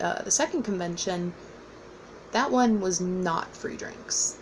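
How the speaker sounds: ordinary delivery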